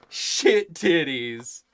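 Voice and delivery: loud, drawn-out punchline blurt; kid's proud blurt. Retold or imitated. Dad retelling his son's painted words, loud drawn-out blurt. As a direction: blurt it slow and loud, voice lifted.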